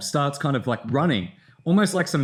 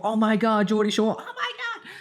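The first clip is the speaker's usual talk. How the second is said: mock fangirl squeal, much higher, trailing into a laugh; imitating screaming fans; 7 semitones higher than usual. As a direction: ham up the starstruck crowd, pitch shooting up. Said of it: "everyone just starts freaking out".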